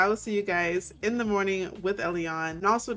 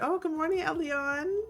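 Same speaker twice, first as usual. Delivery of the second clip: higher-pitched, lilting hello; cheery greeting; 8 semitones higher than usual. Acted. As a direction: greet her sweetly in a raised voice. Acting out Adrian's morning greeting.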